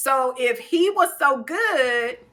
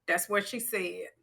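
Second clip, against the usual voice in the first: normal talking voice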